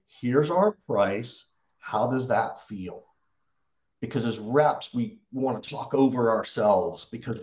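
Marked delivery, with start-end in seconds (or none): 0.1-3.1 slow it right down, "Here's our price" said calmly; slow, calm delivery. Shown as a demonstration. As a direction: ask it slowly and calmly, no rush. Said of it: "get comfortable to just say".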